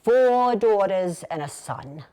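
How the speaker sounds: put-on slow, drawn-out New York dad accent; proud New York accent. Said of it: "would say proudly in his New York accent".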